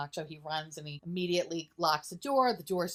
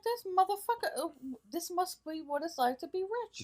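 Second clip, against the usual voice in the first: high mock voice; 11 semitones higher than usual. Mocking viewer's own reaction.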